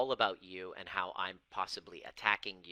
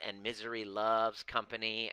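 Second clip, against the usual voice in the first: normal talking voice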